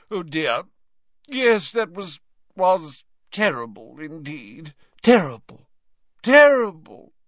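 put-on high, rising to loud flustered old man; flustered old professor. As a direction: splutter it, at a loss. Slughorn flustered.

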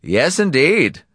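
high, slow, indulgent reply. A narrator's reading. Donati humouring the man on the line, indulgently. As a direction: indulge them, loud and drawn out. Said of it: "Donati chuckled indulgently".

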